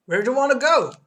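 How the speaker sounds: loud, high, brash New York cabbie; bad New York accent. Cab dispatcher yelling at the caller, terrible New York accent. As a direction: bark it like a pushy New York cab driver. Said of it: "I'm doing a terrible accent".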